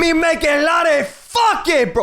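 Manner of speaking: very high, hammed-up broken French accent; Poha Jirafa. French character boasting to the hosts, high hammy accent. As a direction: squeal it as a cartoon French guy. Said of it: "just do Poha as a French guy".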